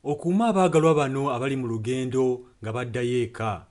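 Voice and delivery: ordinary talk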